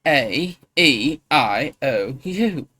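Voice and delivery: loud, very slow vowels; angry vowels. Shown as a demonstration. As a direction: drag each vowel out loud and annoyed for the mic. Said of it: "You'll hear me angry".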